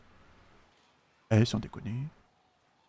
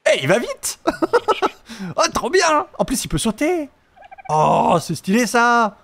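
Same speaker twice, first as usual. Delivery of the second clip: delighted; 6 semitones higher, slower than usual. Real, not acted. Riding new dodo.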